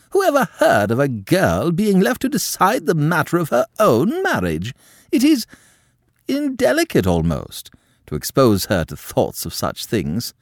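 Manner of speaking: high, loud, shocked noblewoman; scandalised countess. A narrator's reading. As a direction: clutch your pearls at the very idea. Madame outraged at Quentin.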